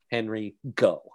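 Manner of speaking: ordinary talk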